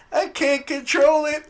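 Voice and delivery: high, slow mock whine; hammed-up high whine of I can't control it; whiny Jean. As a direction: pitch it up like a helpless girl. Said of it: "And she's like".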